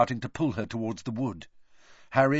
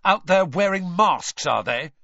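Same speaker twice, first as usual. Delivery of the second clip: louder, higher accusing jab; Harry accusing Malfoy's parents, louder and higher; temper rising; 7 semitones higher, 5 dB louder than usual. A narrator's reading. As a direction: throw the accusation at him. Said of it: "his temper rising".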